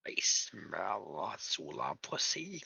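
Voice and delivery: very low, slow growling creature; creepy deep voice. Acted. S3 voicing a creature to the party, deep and slow. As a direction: drop deep and slow like a monster. In-character creature line at table.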